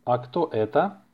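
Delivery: ordinary delivery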